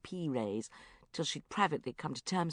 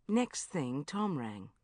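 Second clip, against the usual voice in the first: ordinary delivery, nothing marked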